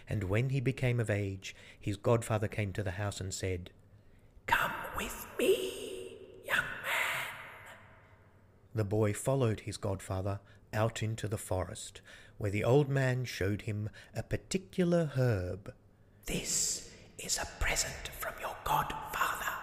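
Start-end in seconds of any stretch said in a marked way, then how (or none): 4.5-7.3 slow eerie whisper; slow, breathy Death voice. Acted. Death accepting the godfather role, slow and whispered. 16.2-19.6 Death. Acted. Death voiced.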